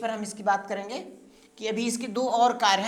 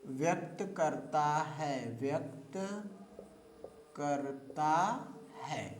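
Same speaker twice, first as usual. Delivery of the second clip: slow, softer dictation with long gaps; teacher dictating to a class, slow and soft; 4 semitones lower, 6 dB quieter, slower than usual. Shown as a demonstration. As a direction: read the notes out word by word for copying. Dictates notes to students.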